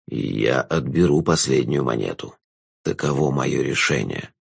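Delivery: low, slow, drawn-out jester voice; a knowing villain savouring the moment; low, slow jester passing judgement on the girl; sly jester. A narrator's reading.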